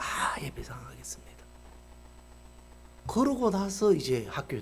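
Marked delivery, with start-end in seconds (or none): 0.0-1.3 hushed, voiceless agreement; meek whisper. Retold or imitated. Principal meekly agrees to pay the shop owner, whispering. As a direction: whisper it humbly.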